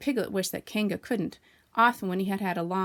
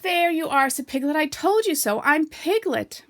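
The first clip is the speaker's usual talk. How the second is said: higher, louder, slow triumphant squeak; Piglet triumphant; 8 semitones higher, 7 dB louder, slower than usual. A narrator's reading. Piglet to Kanga, triumphant high squeak. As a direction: crow that you were right.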